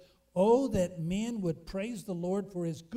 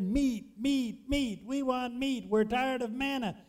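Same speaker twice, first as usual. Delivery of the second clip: mock chant of meat, rising a little each time; lightly hammed-up complaining crowd; whining crowd; 4 semitones higher, slower than usual. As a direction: play the grumbling Israelites clamoring. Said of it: "they cried".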